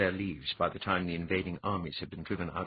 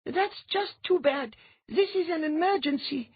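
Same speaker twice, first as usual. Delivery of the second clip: queen's insistence; 4 dB louder than usual. A narrator's reading. Andine insists against objection.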